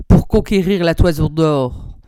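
plain reading voice

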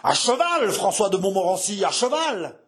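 high, ringing military order; harsh metallic command. Acted. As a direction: order your son out in a harsh ringing cry. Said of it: "d'une voix rauque, métallique". (ordre rauque et métallique)

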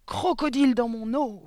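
slow, sputtering build to a high shout; halting fury rising to a yell; vociferated accusation. A narrator's reading. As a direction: choke on outrage, then explode. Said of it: "vociféra mademoiselle Le Gourdin".

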